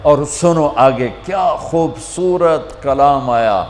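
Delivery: normal talking voice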